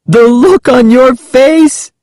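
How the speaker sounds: put-on high, drawn-out, gleeful girl's exclamation. A narrator's reading. Prankster to scared cousin after laughing, gleeful high exclamation. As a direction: exclaim it high, mocking the victim. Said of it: "she exclaimed when she finally stopped laughing".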